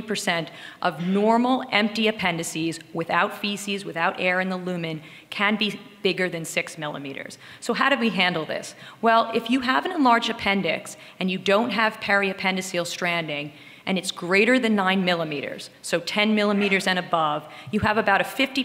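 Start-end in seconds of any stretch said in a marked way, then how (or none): none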